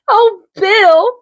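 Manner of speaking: normal talking voice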